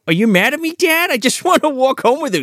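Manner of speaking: put-on high, pleading little boy; a small boy hurt that dad is angry, voice up high; kid voice. Podcaster voicing Jerry pleading with his father, high child voice.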